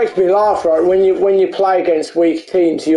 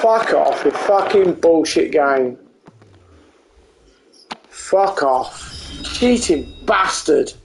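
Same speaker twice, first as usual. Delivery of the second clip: swearing tirade; 5 semitones lower than usual. Real, not acted. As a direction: mutter the curses in disgust. Player muttering after goal.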